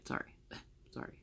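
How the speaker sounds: whispered, fast, laughing sorry; giggling apology. Real, not acted. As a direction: giggle through a quick embarrassed sorry. Laughs at her own mistake.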